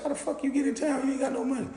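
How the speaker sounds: high, disbelieving squeal; mock outraged shriek; incredulous. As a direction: act stunned she came broke. Retold shock at broke girl.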